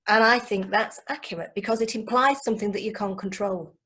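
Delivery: ordinary talk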